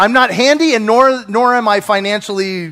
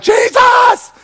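high, loud panicked exclamation; startled shout; alarmed cry; 4 dB louder than usual. Retold or imitated. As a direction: blurt the name in a sudden fright. Panicked reaction to the email.

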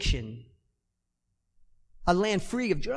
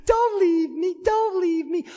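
high, pleading voice of a tearful wife, raised to a cry on the last lines; imitating wife near tears; 11 semitones higher than usual. Wife begging husband to stop the clip, tearful high cry. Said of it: "She's like".

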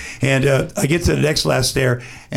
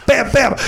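slap the punches out with your voice; punch sounds; 9 semitones higher than usual. Retold or imitated. Retelling the fight to the host, punching sounds.

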